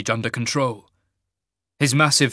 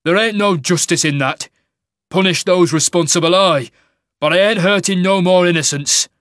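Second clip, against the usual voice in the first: loud, raised, indignant protest; rough-spoken warrior standing his ground; defiant Cain; 6 semitones higher, 8 dB louder than usual. Acted. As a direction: push back hard against the order.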